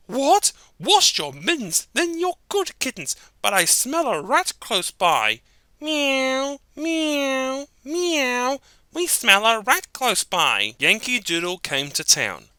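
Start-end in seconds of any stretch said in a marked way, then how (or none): 0.1-5.4 pleased then wary mother; suspicious mother cat. Acted. 5.8-8.6 tiny high meows; high kitten mews. Acted. Kittens mew. 8.9-10.8 very high eager kitten voice; excited high kittens. Acted. Kittens agree.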